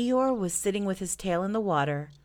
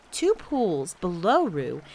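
plain reading voice

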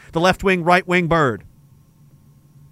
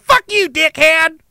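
high, loud, slow thug snarl; a hammed-up modern tough-guy insult; mock brawler; 10 semitones higher, 5 dB louder, slower than usual. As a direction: sneer it like a drunk street fighter. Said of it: "Now we're just like".

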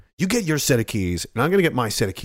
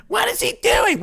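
high mocking voice. Voicing a puzzled viewer.

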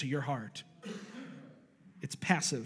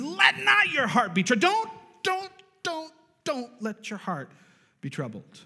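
shouted command; 9 semitones higher, 3 dB louder than usual. Acted. Preacher to congregation, shouted then fading. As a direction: cry it out in capitals. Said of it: "it's in all capitals, bolded with, like, four exclamation points".

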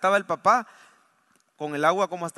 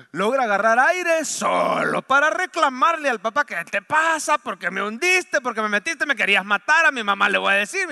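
put-on higher and louder, indignant child yelling; boy yelling at his dad after surfacing, angry; angry boy complaint; 5 semitones higher, 7 dB louder than usual. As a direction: accuse the father like a furious kid.

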